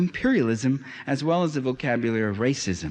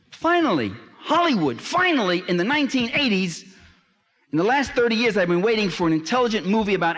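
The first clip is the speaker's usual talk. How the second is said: lecturer venting at Hollywood for the audience, exasperated and raised; exasperated outcry; 7 semitones higher than usual. Real, not acted. Exasperated build-up about Hollywood.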